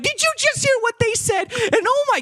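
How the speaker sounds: high, gasping gossip voice; gossipy shocked viewer. Retold or imitated. Viewer after a show, high shocked gossip. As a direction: squeal it like juicy gossip.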